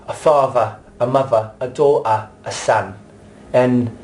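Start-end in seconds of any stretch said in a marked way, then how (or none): 0.1-3.0 a softened London accent, each word falling; light London accent with "father" said as "farva"; toned-down London accent. Shown as a demonstration. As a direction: compromise London accent that stays clear to the audience. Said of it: "we'd say".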